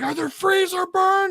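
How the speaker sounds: high mock interrogation; manic question. Maniac throwing popsicles at captive, high manic question.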